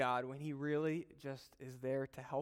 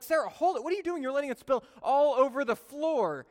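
angry yell; 10 semitones higher, 6 dB louder than usual. Retold or imitated. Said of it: "I got so angry at Sarah".

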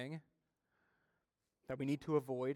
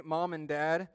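normal talking voice

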